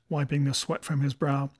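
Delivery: plain reading voice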